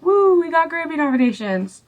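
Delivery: high, hammed-up whoop; mock cheer. The band after losing the Grammys, high mock cheer. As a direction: cheer like a consolation prize. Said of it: "They're still like".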